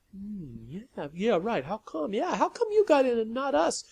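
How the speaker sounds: put-on petulant kid-like complaint; rebellious children. A narrator's reading. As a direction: whine it like bratty kids. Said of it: "they sounded like rebellious children".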